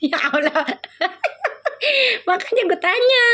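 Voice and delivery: high giggling burst breaking into words; laughing. Real, not acted. Host laughing at a sulking viewer, giggling high.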